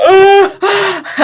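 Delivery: loud high "Ah!" yelp; a sudden hammed-up shriek; playful high exclamation; mock cry. Player wafts into kitchen.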